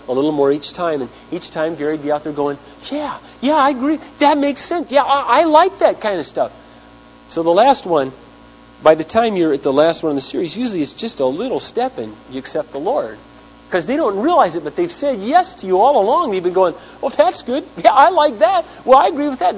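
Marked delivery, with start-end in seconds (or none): 2.9-6.5 much higher, eager yes-man voice; a hammed-up eager nodding listener; eager agreeing voice. Pastor voices Gary agreeing eagerly. 17.0-19.6 much higher, chirpy agreeing listener; imitating a nodding churchgoer; mock eager agreeing. Voicing listeners agreeing unawares.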